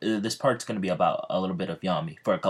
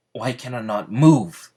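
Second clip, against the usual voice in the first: frantic cry; 4 semitones higher, 8 dB louder than usual. Acted. Yami frozen, unable to move.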